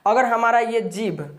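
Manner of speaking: normal talking voice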